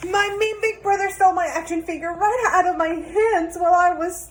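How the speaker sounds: put-on high, tearful little boy's wail; upset kid complaining between sobs; crying child. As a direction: blurt out the whole sad story through tears. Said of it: "Until the little boy cried".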